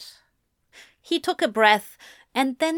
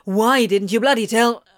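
loud, angry reproach; demanding; 5 dB louder than usual. A narrator's reading. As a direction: hurl the question at him. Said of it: "Crowley demanded".

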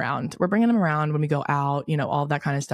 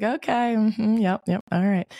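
higher, stiff mm-hmm yep of someone freaked out; awkward polite agreement; 6 semitones higher, slower than usual. Retold or imitated. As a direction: humour her while backing away. Date freaked out by doll photo.

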